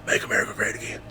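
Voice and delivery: whispered incredulous echo; hushed shocked question; hushed disbelief. Retold or imitated. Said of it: "We're like".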